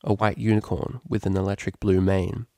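ordinary delivery

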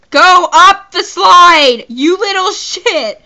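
loud, slow, drawn-out order; play-yelling at a game character; mock shouted command. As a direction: belt out the order, stretching the words.

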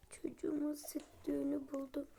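soft whisper; hushed whisper of relief. Real, not acted. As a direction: whisper the good news. Whispering while baby near.

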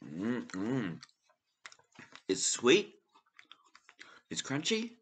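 talking with mouthful of cookie; speaking between bites; chewing while talking. Real, not acted. Seen 0: Bites cookie at -5, jaw and closed lips keep chewing through words.

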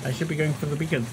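ordinary delivery

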